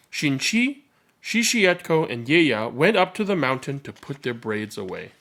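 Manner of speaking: ordinary delivery, nothing marked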